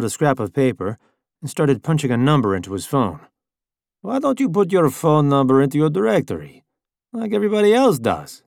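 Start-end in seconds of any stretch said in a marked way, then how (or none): 4.0-8.4 higher, pushy driver voice; Italian driver voice. A narrator's reading. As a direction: needle him like a rude driver.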